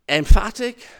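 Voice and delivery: sudden loud punch on "Emphatic". Shown as a demonstration. Preacher recaps the Greek phrase's parts, emphatic.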